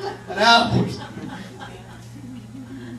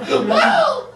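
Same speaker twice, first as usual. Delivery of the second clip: high mock yelp. Panicked yelp after screaming line.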